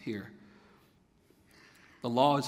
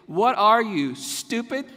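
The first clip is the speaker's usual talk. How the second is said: mock incredulous; 6 semitones higher, 5 dB louder, slower than usual. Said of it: "what he means is to say".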